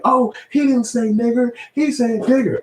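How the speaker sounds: very high, sing-song defense of the coworker; mocking coworkers backing up the accused man. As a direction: chime in like prissy bystanders.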